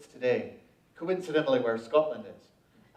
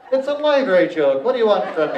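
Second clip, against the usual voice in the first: laughing; 6 semitones higher, 6 dB louder, faster than usual. Real, not acted. Laughing after the joke.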